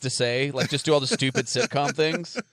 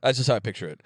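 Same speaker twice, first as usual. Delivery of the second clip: ordinary delivery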